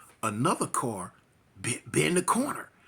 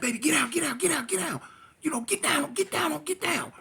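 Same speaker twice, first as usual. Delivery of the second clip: higher and higher urgent commands; urgent orders; 12 semitones higher than usual. Retold or imitated. Driver yelling at girlfriend to get down, rising urgency. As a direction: bark at her to get down.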